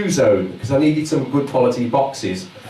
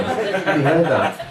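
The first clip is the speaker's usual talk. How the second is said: ordinary delivery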